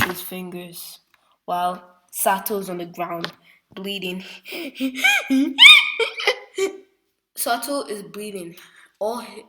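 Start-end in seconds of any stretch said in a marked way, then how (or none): 4.3-6.8 high burst of laughter; big laugh. Real, not acted. Storyteller cracking up at his own gory scene. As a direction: laugh out loud at the gore.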